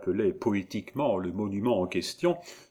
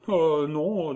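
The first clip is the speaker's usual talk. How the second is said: high-pitched gentle denial; lightly acted protest; reassuring protest; 8 semitones higher, 4 dB louder than usual. A narrator's reading. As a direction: deny it in a raised girlish voice. Gervaise protests his fear.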